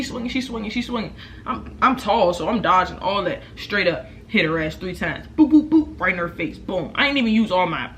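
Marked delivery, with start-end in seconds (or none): 5.4-6.0 louder, higher mock punch noises; punch sound effects. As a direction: pop out each punch sound. Imitating punches.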